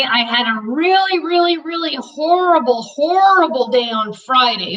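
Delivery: lightly voiced pet's complaint; voicing the dog. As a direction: speak for the dog, pitiful.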